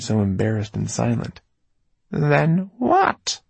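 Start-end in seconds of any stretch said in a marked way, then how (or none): 2.1-3.4 high, rising demand; sharply raised, insistent question; insistent repeat. A narrator's reading. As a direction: press the question, pitch climbing. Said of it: "she repeated".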